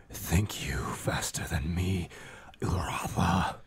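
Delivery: eerie unvoiced creature whisper; dark whisper behind you.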